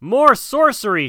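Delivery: high, loud crowd cry; villagers shouting. A narrator's reading. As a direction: call out in alarm like villagers. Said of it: "shouted the villagers".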